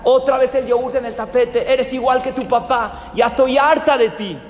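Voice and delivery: a mother yells at her little son over spilled yogurt; yelling at her son. Acted. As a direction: scold the child in a fury. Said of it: "Le empieza a gritar".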